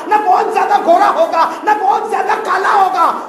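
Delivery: high, loud impassioned sermon voice, half laughing; full-force preaching. Acted. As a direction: cry the Prophet's words out to the crowd. Preaching at full force, quoting Prophet.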